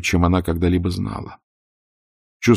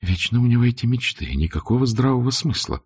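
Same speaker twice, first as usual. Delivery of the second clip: irritated thought; 6 semitones higher than usual. A narrator's reading. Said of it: "зашевелилось былое раздражение".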